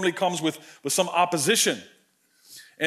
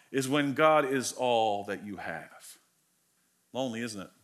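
hushed; 7 semitones lower, 6 dB quieter than usual. Real, not acted. Reflective sermon line fading soft.

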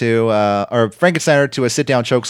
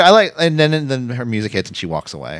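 ordinary talk, nothing marked

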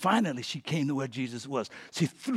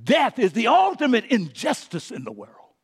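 indignant declaration building louder and higher; angry protest; 6 semitones higher, 7 dB louder than usual. Acted. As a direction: protest death with rising preacher's anger. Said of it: "He too is angry".

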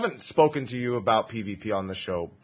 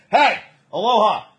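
mock shout; 9 semitones higher, 7 dB louder than usual. Host interrupts someone jokingly.

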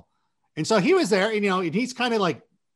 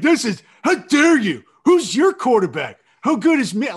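higher, louder indignant fan rant; mock outraged fan; 5 semitones higher, 7 dB louder than usual. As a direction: ham up an offended Vikings fan. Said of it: "They're so upset".

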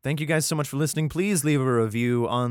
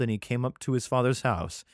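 ordinary delivery

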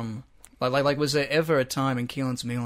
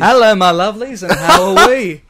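loud, high-pitched catchphrase impression; booming mock video-host welcome; Paulie V; 5 semitones higher, 13 dB louder than usual. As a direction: belt out the host's greeting, hammed-up. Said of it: "you know, Paulie V".

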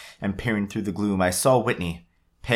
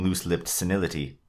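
ordinary delivery

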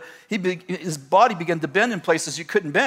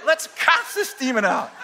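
eager excitement; 8 semitones higher than usual. Retold or imitated. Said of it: "he was so excited".

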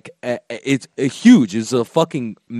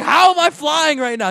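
high-pitched mock yell of bewilderment; imagined yelling; 12 semitones higher than usual. Voicing the kid's feeling about flying, high mock yelling. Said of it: "He's probably yelling".

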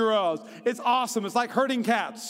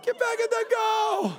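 high shouted yell at the goalie; 12 semitones higher than usual. Retold or imitated. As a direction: yell him back into the goal. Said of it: "I was like".

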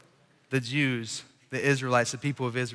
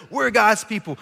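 proud claim; 7 semitones higher, 5 dB louder than usual. Retold or imitated. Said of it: "the one thing they can say".

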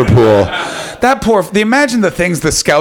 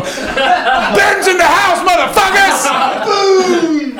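hype shout; much slower than usual. Pretend. Hyping the crowd, mock announcer.

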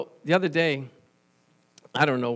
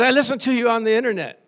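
imitating caller; 7 semitones higher, 7 dB louder, faster than usual. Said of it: "She says".

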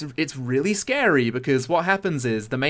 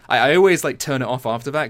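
ordinary talk

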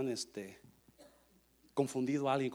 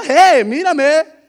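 high, loud, stretched-out shout; calling out; 13 semitones higher, 20 dB louder, much slower than usual. Acted. Jesus speaking to Mary who doesn't know him, calling out. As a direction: holler slowly for attention.

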